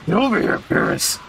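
high, strained catchphrase call; Beerus impression. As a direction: call it out like a game villain. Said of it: "He talks like that".

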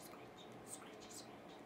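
barely audible scratchy whisper; scratchy noise. Shown as a demonstration. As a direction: scratch like something in the dark. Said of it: "Maybe it's going scratchy".

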